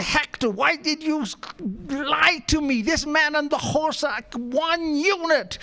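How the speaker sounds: angry director. Retold or imitated. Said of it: "He got so angry".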